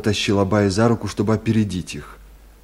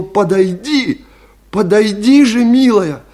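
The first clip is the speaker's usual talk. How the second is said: loud, high, drawn-out call; crying out; 10 semitones higher, 5 dB louder, slower than usual. Acted. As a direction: call out desperately to a beloved. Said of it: "крикнул". (страстный громкий крик)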